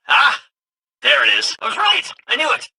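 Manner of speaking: high, triumphant burst settling back down; excited discovery. Acted. Helper finding the thing he suspected, excited. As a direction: crow over being proved right.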